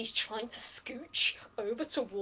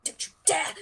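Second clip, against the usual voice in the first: sharp, raised, louder warning; outraged warning; 6 semitones higher, 4 dB louder than usual. Acted. Captive warns adventurers off her loot, outraged. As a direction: cut them off in outrage.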